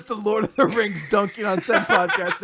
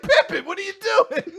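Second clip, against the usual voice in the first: mock blaming shout; 11 semitones higher, 5 dB louder than usual. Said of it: "He's like".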